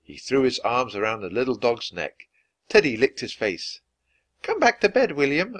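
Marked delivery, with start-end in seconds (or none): 4.4-5.5 high call from another room. Acted. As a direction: call him back to bed. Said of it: "a voice called from inside the room".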